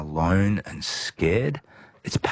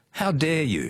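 high-pitched mock outrage; imitated indignation; 10 semitones higher than usual. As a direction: act out someone taking offence, pitched up. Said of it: "he's like".

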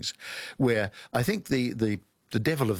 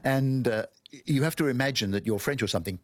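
normal talking voice

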